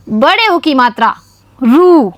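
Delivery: ordinary talk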